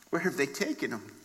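soft, quick, hushed question; understated grief. Acted. Grieving Mary asking where they took him, hushed. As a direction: ask it quietly, as if lost in mild grief. Weeping Mary asks for her Lord.

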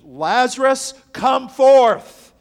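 loud, high command to come out of the tomb; booming call. Acted. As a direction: call him out of the grave at full voice. Jesus calling dead man out.

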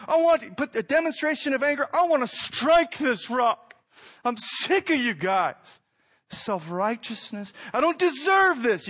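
high, loud, fed-up rant; angry Moses. Acted. Preacher voicing Moses ranting at the people, angry and loud. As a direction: vent like a furious prophet. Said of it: "Moses so furious, so frustrated".

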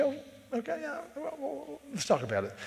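high, light voice of a mild, patient parent; soothing parent. Retold or imitated. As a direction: play a parent gently calming a grumbling child. Parent answering child after church.

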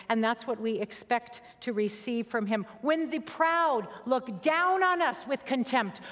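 ordinary talk, nothing marked

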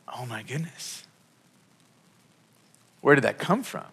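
breathy, hushed oh my goodness in wonder; hushed awe. Retold or imitated. Pastor recalling his stunned reaction to a friend's word, hushed awe. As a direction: let it fall out of you, stunned and quiet.

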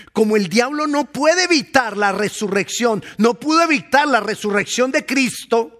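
high, loud full-force sermon; fiery preaching. Acted. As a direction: preach it with fire.

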